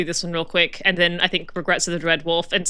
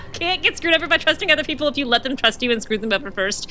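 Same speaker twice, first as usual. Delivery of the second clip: higher-pitched, laughing through the words; 6 semitones higher than usual. Real, not acted. Streamer joking that Solas screwed others first, laughing. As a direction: talk on through real laughter.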